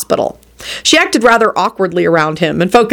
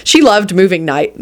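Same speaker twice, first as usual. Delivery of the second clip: ordinary delivery, nothing marked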